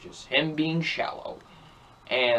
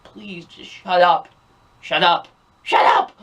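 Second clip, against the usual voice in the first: exasperated plea building to a loud bark; mounting mock fury at a whiny character; exasperated outburst; 3 semitones higher, 6 dB louder than usual. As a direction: build from begging to shouting, fed up. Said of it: "I was just like".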